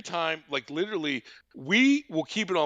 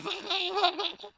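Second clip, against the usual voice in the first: high goofy stoner laugh; big stoner laugh. Acted. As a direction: laugh like a stoner who just got it. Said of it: "he gives this big stoner fucking laugh".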